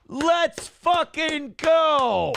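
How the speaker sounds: celebrating the goal, long and high; hype cheer. Real, not acted. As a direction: stretch it out like a victory yell. Cheering the donation goal progress.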